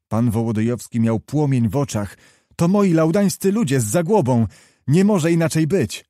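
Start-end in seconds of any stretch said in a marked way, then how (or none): 2.6-6.0 higher-pitched eager exclamation; keyed-up, certain outburst; excited recognition. A narrator's reading. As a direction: announce your own men with rising excitement. Wołodyjowski joyfully recognises his men.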